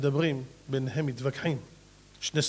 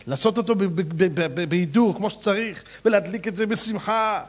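usual speaking voice

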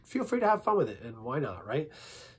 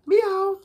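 high, loud meow; cat meow; 8 dB louder than usual. Shown as a demonstration. Warming up voices with animal sounds, cat meow. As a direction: mimic a cat's meow, pitched right up. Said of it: "For a cat".

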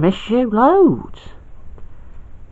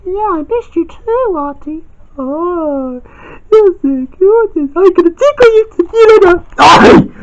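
put-on high girl voice; 11 semitones higher than usual. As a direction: greet Auntie fondly, high. Girl figure greets auntie.

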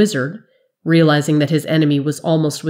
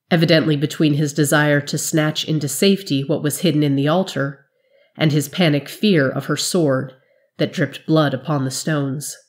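plain reading voice

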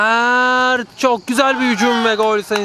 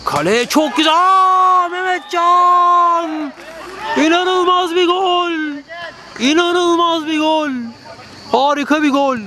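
screaming football commentator; 13 semitones higher, slower than usual. Real, not acted. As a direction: roar the goal call at full voice. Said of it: "goal scream by the commentator".

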